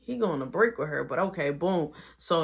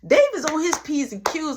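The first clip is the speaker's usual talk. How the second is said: ordinary talk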